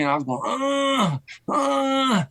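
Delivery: high strained grunts of pain after a body shot; retold winded groaning; pained groans. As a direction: groan like you're winded from a left hook. Gut-punched after the body shot.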